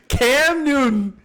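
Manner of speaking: high laughing shout of the name, slowed; cracking up while saying Cam Newton. Real, not acted. Laughing at his own Cam Newton trade pitch.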